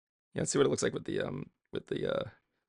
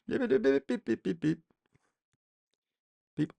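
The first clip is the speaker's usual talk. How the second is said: mock train beeps; 8 semitones higher than usual. Imitating train horn.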